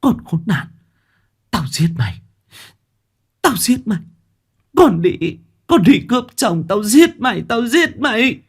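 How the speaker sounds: high-pitched murderous rage; seethe, then build into frenzy; shrieked through teeth. A narrator's reading. Chanh strangling Lam, shrieking in rage. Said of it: "mà rít lên".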